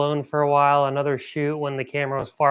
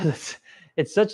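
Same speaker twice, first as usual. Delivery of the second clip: ordinary talk, nothing marked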